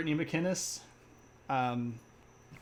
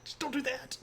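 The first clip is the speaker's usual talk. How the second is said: high mock-wife protest; high, playful scolding impression; 11 semitones higher than usual. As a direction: imitate a wife objecting, pitched way up. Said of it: "And it's always like with the".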